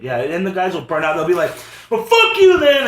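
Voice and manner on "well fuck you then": loud, high mock outburst; imitated parting insult. Burnt-out guy gives up and walks away, imitated angry parting shot. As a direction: throw out a loud, high-pitched brush-off.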